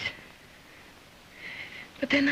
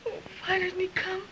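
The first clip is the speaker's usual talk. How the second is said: crying; 6 semitones higher, 4 dB quieter than usual. Acted. Woman waiting for the doctor, crying.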